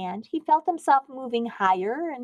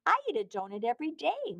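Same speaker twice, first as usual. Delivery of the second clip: sheepish; 5 semitones higher, 4 dB quieter, faster than usual. A narrator's reading. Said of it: "said sheepishly".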